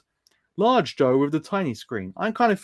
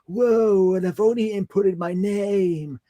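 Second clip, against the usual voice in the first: Milhouse voice; 6 semitones higher, much slower than usual. Retold or imitated. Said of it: "and he's like".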